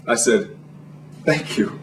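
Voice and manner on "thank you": small, soft, flattered reply; hushed mock-modest acknowledgement; coy thanks. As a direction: say it bashfully, very quietly.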